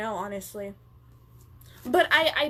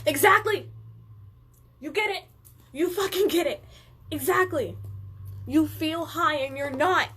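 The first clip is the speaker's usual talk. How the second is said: high, emphatic outburst of recognition; thrilled to be understood, pitched up; real, punchy excitement; excited agreement; 4 semitones higher, 3 dB louder than usual. Real, not acted.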